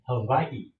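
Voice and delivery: "Hawaii" drawn out with a V sound; local's pronunciation with V. Acted. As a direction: answer slowly, "Hawaii" as ha-VAI-ee. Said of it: "we say".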